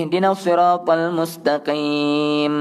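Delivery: slow chant with a long final m on "mustaqim"; recited "mustaqim" ending in a sustained hum; clear held mim. Shown as a demonstration. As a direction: close the lips and hold the m of "mustaqim". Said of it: "bibir tutup dan lama sedikit".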